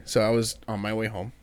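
ordinary talk, nothing marked

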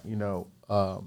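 normal talking voice

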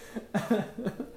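high, helpless giggle; laugh. Real, not acted. Host laughing at his own bad advice, giggling. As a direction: giggle after a long pause.

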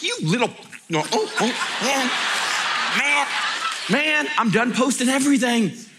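high, laughing, half-exasperated; laughing exasperation. Retold or imitated. Preacher laughing at online critics, high and exasperated.

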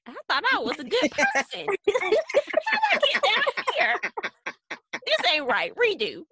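high, swooping comic impression with laughter breaking in; Leslie Jordan. Friend joking about waking up in hell, high-pitched indignant celebrity impression. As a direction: pitch jumps high, playing up indignant disbelief. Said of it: "Leslie Jordan voice".

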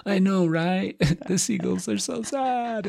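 high, slow mocking whine; mock sad commenter. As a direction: overdo the fake grief. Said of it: "the people that are like".

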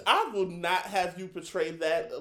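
mock outrage. Mock outrage at being teased.

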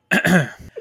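loud throat clear. Real, not acted. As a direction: clear throat before speaking. Clearing throat before intro.

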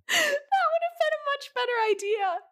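put-on very high squeaky girl voice; high girly voice. Pretending to be the actress.

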